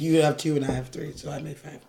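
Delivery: usual speaking voice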